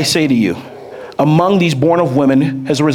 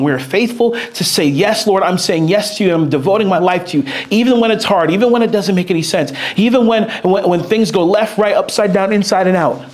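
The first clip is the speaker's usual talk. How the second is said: fervent preaching; 5 semitones higher than usual. Real, not acted. Preaching builds on faithfulness.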